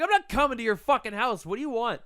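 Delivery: indignant refusal. Retold or imitated. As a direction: push back hard at the stranger. Acted refusal to the stranger.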